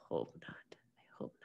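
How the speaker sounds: softly whispered hope not. Real, not acted. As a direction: breathe it out as a quiet wish. Hoping riots don't repeat.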